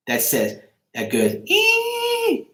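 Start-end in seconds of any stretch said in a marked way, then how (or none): 1.5-2.5 shrill falsetto squeal like a rat; high demon squeak. Retold or imitated. Streamer imitating the demon leaving the house, high squeal. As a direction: squeak like a tiny demon fleeing. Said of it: "that high creature, that high squeaking demon".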